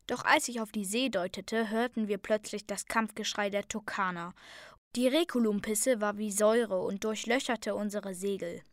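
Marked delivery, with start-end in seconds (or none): none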